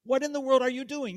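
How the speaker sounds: loud, high, disbelieving yell; exasperated yell. Retold or imitated. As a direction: lean hard on world in disbelief. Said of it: "I go".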